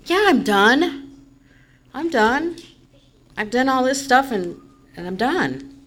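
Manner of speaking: hammed-up defiant insistence, drawn out; insistent retort. Venting to her sponsor Nancy, defiant and insistent. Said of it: "I'm like".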